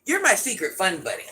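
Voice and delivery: ordinary delivery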